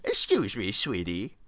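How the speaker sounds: slurred, lisping granny voice; hammed-up toothless granny; mock old lady. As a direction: do a sweet little old lady asking politely. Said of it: "punching an old lady out of context".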